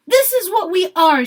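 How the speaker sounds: loud, high rallying cry; fiery speech shouted to the crowd; yelled. A narrator's reading. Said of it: "she yelled".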